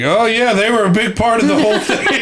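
high, forced brightness; chirpy, scripted enthusiasm; forced cheerful. Retold or imitated. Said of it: "She just looks like".

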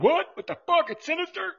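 high, startled mock disbelief; shocked disbelief. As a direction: squeak out a stunned what. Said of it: "He's probably like".

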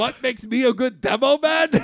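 put-on high, loud, hammy Scottish accent; mock Scottish accent. Co-host joins the joke as demo man, loud Scottish accent. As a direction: shout it in a silly Scottish accent. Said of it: "The Scottish accent has arrived".

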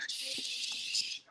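long, soft shushing hiss; imitated shush. Woman hushing an angry caller, drawn-out shush. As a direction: imitate her shushing you. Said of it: "Don't tell me to shush".

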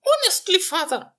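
high, loud exasperated princess; a spoiled royal daughter scolding dad; cartoon princess. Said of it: "the voice of a princess".

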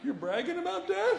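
shocked, pitch leaps up; incredulous. Retold or imitated. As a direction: passenger shocked by the pilot's joke, high. Incredulous reply to pilot.

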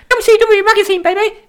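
loud, high-pitched squealing hype man; woo voice. Acted. Host hamming up a wrestling magazine advert, high woo voice. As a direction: squeal the plug at the top of the voice. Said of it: "doing it in the woo voice".

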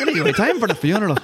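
giggly stage-Irish sign-off; mock Irish Banshee. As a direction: wrap it up in a silly Irish accent. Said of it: "I just did an impression of".

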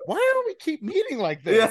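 high, incredulous whine on "Why do we keep doing this?"; exasperated mock question. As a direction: sound fed up and baffled. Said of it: "they're like".

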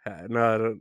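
ordinary delivery

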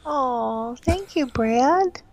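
high, slow, mock-flattered thank you. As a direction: swoon over the cheesy compliment, drawn out. Answers mock pickup line.

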